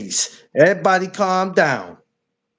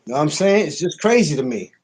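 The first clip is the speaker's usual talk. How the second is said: ordinary delivery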